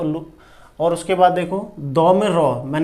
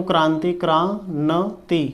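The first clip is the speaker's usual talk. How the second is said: Kranti drawn out slowly; slow spelled-out Kranti; slow dictation; slower than usual. Shown as a demonstration. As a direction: stretch Kranti syllable by syllable. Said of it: "teacher spells while writing".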